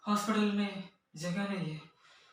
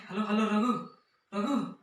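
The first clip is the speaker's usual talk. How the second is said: urgent, louder hello down the line; raised voice, anxious to be heard; calling into phone; 2 semitones higher, 5 dB louder than usual. Acted. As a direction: call after a friend who is hanging up. Caller being cut off on phone.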